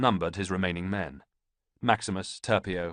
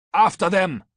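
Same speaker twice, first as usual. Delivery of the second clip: full-throated battle bellow, much higher and louder; 12 semitones higher, 10 dB louder than usual. A narrator's reading. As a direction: roar the order at the fleeing enemy. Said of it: "bellowed Ballista".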